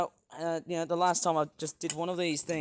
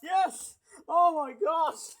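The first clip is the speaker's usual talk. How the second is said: high, thrilled yelp of delight; excited cheer; 9 semitones higher, much slower than usual. Real, not acted. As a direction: cheer like the toy just exploded. Reacting to crash.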